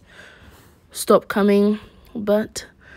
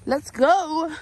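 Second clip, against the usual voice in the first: excited; 7 semitones higher, 6 dB louder, slower than usual. Real, not acted. Cheerful sign-off to camera.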